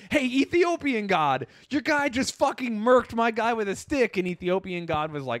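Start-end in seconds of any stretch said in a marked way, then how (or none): 0.1-4.2 high-pitched complaining deity; Neanderthal God. Acted. One god complains to another about a killing, indignantly. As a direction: call out a fellow god in mock outrage. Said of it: "Neanderthal God was like".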